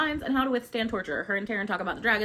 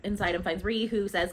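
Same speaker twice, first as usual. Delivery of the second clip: usual speaking voice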